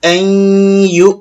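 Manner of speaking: আই ইউ with a held nasal hum between the syllables; ghunnah. Shown as a demonstration. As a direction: hum through the nose before ইউ. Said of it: "এখানে গুণা করতে হবে".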